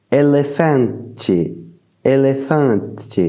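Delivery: elefante slow, with a nasal an; slow Portuguese elefante. Shown as a demonstration. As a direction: elefante drawn out syllable by syllable. (elefante lentement, an nasal)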